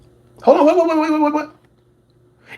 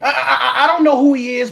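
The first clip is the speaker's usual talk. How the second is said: ordinary talk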